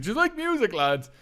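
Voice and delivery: lightly voiced chatty driver. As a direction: ask the lads eagerly.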